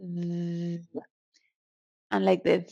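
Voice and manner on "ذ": "Z" as a long, low voiced-th drone; softly held voiced th for "Z"; fly buzz. Shown as a demonstration. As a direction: buzz "Z" like an annoying fly, tongue between teeth. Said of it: "the annoying sound that flies create".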